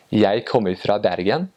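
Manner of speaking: Eastern Norwegian, rising at the end; Eastern Norwegian dialect. Shown as a demonstration. Showing how an Easterner names Bergen, Eastern Norwegian dialect. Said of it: "in the Eastern dialect, I would say".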